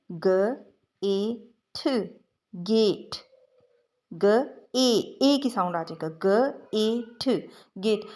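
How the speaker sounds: "ग, ए, ठ" sounded apart, then joined into "गेट"; teacher sounding out "गेट"; blending demo. Shown as a demonstration. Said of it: "उसकी original sound ए पे आ जाना है".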